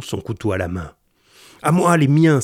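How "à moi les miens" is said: high, loud cry to his men; loud, high alarm call; shouted call for help. A narrator's reading. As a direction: yell for backup while backing away. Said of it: "s'écria André Vasling en reculant".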